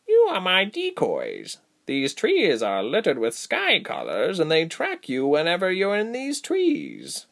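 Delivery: high, slow, deliberate; stretched-out, commanding creature voice; female creature. Acted. Female creature explaining decoys to captives, deliberate.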